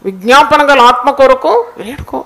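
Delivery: loud, urgent exhortation; fervent preaching. Real, not acted. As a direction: drive the plea home to the congregation, forcefully. Preacher exhorts congregation to weep. (ఆవేశంగా, గట్టిగా ప్రసంగిస్తూ)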